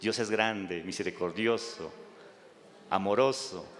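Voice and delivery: ordinary talk, nothing marked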